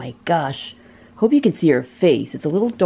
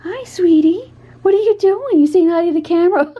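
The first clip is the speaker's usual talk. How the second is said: much higher, sing-song greeting; 11 semitones higher, 4 dB louder than usual. Real, not acted. To the cat Babsy, high baby-talk hello.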